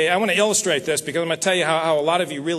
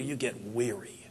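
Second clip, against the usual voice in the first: weary; 7 semitones lower, 13 dB quieter than usual. Acted. Speaker describing weariness.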